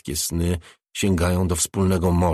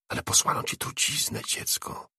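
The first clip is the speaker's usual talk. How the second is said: hushed warning; 7 dB quieter than usual. A narrator's reading. Spirit warns Angie of poison.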